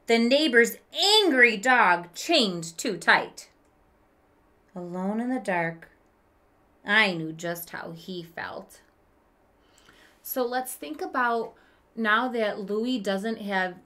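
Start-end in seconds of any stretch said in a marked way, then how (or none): 0.1-8.8 gentle read-aloud storytelling voice; story narration. A narrator's reading. Teacher reading a picture book to kids, storytelling voice. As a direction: read it like a children's book to the class.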